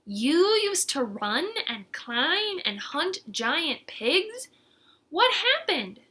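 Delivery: slow, rising pitch of disbelief; astonished. Acted. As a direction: let the amazement climb on pigs. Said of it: "Verdi was astonished".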